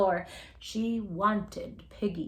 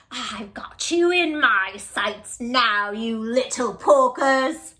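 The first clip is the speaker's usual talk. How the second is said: loud, slow gloating witch; cackling witch; 7 dB louder than usual. Acted. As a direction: gloat down at the pigs. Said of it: "she cackled".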